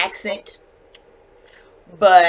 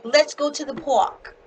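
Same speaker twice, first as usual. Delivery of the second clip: higher Boston accent, park without the r; playful Boston accent demo; 7 semitones higher than usual. Shown as a demonstration. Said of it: "So they'd be like".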